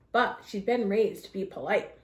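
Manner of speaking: ordinary delivery, nothing marked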